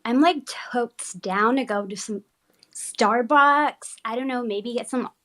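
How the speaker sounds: put-on American accent, California Valley girl. Shown as a demonstration. Guest shows co-hosts her idea of an American accent, California Valley girl. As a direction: lay on a ditzy Los Angeles Valley girl accent. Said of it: "what is in your mind the California accent".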